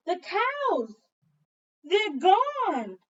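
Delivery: high, loud sudden alarm; shocked discovery; alarmed cry. Acted. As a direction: gasp it out in shock.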